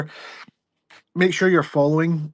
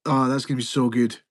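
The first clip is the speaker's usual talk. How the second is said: usual speaking voice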